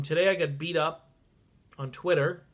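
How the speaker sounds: ordinary talk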